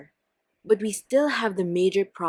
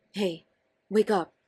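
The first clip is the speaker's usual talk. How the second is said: normal talking voice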